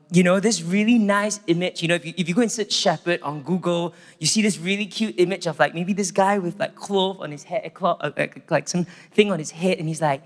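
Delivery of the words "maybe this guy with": ordinary talk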